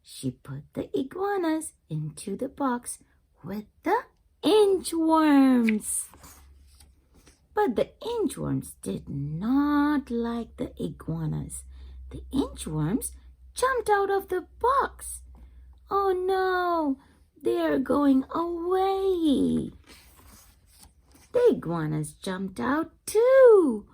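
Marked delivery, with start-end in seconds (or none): 3.4-6.1 drawn out. Acted. Slow, high, louder phrase. 13.5-19.7 excited alarm. Acted.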